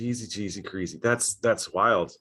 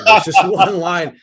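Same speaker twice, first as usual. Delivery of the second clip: laughs mid-sentence, much higher and louder; laughing; 9 semitones higher, 12 dB louder, slower than usual. Real, not acted. Telling the host about his botched line, laughing. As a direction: cracks up over his own blunder.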